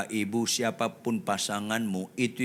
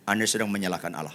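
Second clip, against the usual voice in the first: ordinary talk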